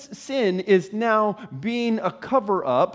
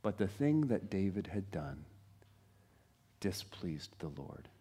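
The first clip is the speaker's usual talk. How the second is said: much softer, low, solemn; hushed repeat; 12 semitones lower, 15 dB quieter than usual. Shown as a demonstration. Preacher repeats the verse, hushed and grave. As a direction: let it sink in, almost whispered. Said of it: "repeated, softer".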